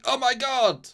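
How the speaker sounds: high, loud, hammed-up gasp of shock; mock shocked cry. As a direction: squeal it out like a stunned android. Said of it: "Quote, he says, "Oh my god!" Like that".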